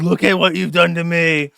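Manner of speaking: a loud mock villain yell, half acted; Two-Face yell. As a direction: bark it at him like a scarred villain. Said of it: "he sounds like Two-Face on the Batman movie".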